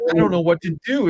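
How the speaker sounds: put-on high panicked kid voice; Home Alone kid. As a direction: play the helpless little kid from Home Alone. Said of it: "like the kid in Home Alone going".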